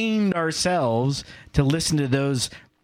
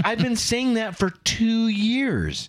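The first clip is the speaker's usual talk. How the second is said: mock exasperated dad; 8 semitones higher, slower than usual. Exasperated parent retort.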